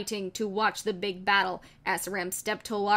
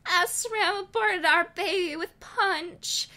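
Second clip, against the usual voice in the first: sad smile; 8 semitones higher than usual. Acted. Mother tells Wheatley of loss, sadly smiling. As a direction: tell him gently through sorrow. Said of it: "I smiled sadly and said".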